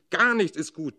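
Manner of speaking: roaring father. A narrator's reading. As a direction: roar it at the whole family. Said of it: "brüllt der Vater". (brüllender Vater, viel lauter und höher)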